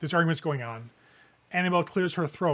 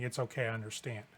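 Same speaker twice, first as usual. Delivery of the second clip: soft reassurance; 5 semitones lower, 8 dB quieter, faster than usual. Acted. Vampire reassures the player character.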